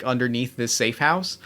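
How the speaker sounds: normal talking voice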